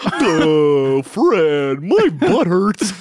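high, loud, slow cartoon whine; cartoon wife voice. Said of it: "we're fucking good at voices".

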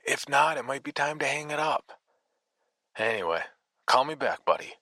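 smarmy music agent brushing him off; condescending slickster. Acted. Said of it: "his usual condescending slickster tone".

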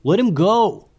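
high, loud call to give in; pleading yell. A narrator's reading. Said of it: "yelled another wizard".

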